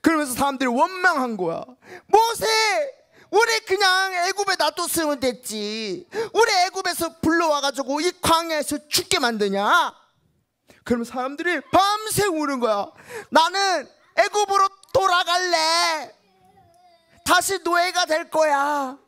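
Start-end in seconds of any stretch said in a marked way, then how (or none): 2.1-9.9 high, whiny blame; grumbling complaint. Acted. People complain to Moses. 13.3-19.0 slow, high, crying; tearful whine. Acted. People crying to return.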